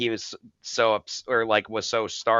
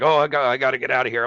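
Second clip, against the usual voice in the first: rushed, louder, panicky words tumbling out; hurried panicky quote; 3 semitones higher, 7 dB louder, much faster than usual. Retold or imitated. Injured man tells helper he must leave, fast and panicky. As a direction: rush it like he's desperate to bolt.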